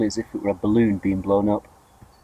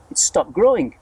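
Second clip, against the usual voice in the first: high cry; 7 semitones higher, 3 dB louder than usual. A narrator's reading. Aunt cries out that it stopped, high. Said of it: "Aunt Spiker cried".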